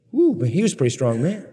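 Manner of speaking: usual speaking voice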